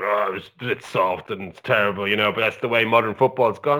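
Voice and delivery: a hint of a shrugging TV pundit; Danny Murphy impression. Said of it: "Danny fucking Murphy on Match of the Day going".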